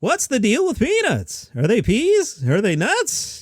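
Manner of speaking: very high comic setup, punchline pending; half-ass Jeff Foxworthy. Shown as a demonstration. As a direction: start the questions way up high. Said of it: "do that as half-ass Jeff Foxworthy".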